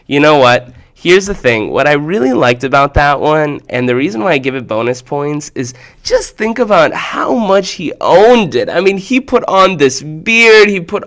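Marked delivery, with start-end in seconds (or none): none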